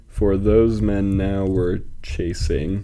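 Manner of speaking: slow, measured scansion; metrical, deliberate pacing; syllable-counting reading. Shown as a demonstration. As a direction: draw out each syllable so it can be counted.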